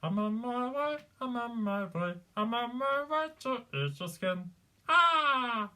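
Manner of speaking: put-on high-pitched throaty kids' puppet voice; Elmo. Shown as a demonstration. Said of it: "So Elmo is a bit like Gollum".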